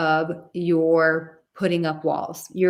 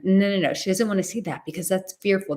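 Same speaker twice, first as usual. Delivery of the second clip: usual speaking voice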